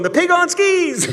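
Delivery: high giggling line; laughing. Retold or imitated. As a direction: crack yourself up. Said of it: "I'm going".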